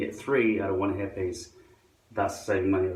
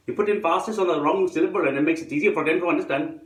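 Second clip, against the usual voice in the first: higher-pitched fake Indian accent, odd stresses; mock Indian accent; 7 semitones higher, 4 dB louder, faster than usual. Shown as a demonstration. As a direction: explain it in a hammed-up Indian accent. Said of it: "pretend to speak with an Indian accent".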